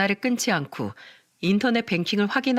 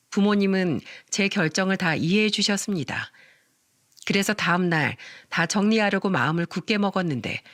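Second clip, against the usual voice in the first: plain reading voice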